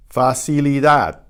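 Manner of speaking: Facilidad with the last D barely heard; almost silent final D. Shown as a demonstration. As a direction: end Facilidad on a near-silent D. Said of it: "The final D is almost silent".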